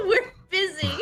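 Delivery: laughing while yelling it back; laughing repeat. Acted. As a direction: echo the call through giggles. Echoing the shout, laughing.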